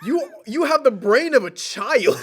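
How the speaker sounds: high, loud, slow disbelief; exasperated outburst. Retold or imitated. Arguing back at a stubborn father, exasperated. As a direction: drag it out loud and high, half laughing.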